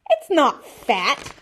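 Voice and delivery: slow high protest, half laughing; indignant yell with a laugh; shouted. A narrator's reading. Boy shouting to defend his girl. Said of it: "I shouted".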